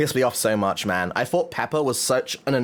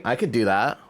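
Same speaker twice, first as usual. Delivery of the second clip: ordinary talk, nothing marked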